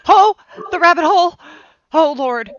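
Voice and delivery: high, loud, mock-despairing cry; dramatic exclamation. Real, not acted. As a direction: throw your hands up at the rabbit hole. Dramatic groan at the topic.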